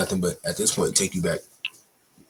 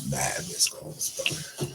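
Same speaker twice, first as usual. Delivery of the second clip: slow stunned disbelief; 4 semitones lower, much slower than usual. Real, not acted. Looking at his phone full of missed calls, slow and stunned. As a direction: drag out mad missed calls slowly, breathy.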